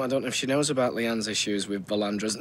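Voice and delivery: usual speaking voice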